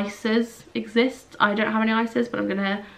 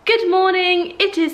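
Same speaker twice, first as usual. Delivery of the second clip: bright greeting; 9 semitones higher, 5 dB louder than usual. Real, not acted. Upbeat vlog greeting.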